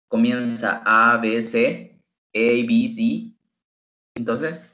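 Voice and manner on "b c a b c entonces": ordinary talk, nothing marked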